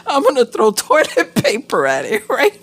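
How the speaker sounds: high, loud words through laughter; giggling at her own plan; laughing. Real, not acted. Vlogger laughing at her toilet paper plan.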